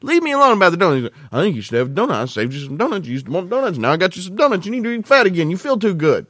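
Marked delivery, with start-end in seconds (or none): none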